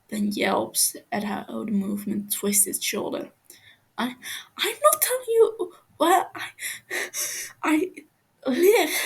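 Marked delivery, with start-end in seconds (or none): 4.0-9.0 high, loud, cracking with half-laugh half-sob; injured prisoner sobbing out his refusal; pained defiance. Acted. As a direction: refuse through tears and pain. Captive defiant through pain.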